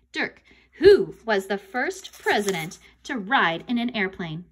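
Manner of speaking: ordinary delivery, nothing marked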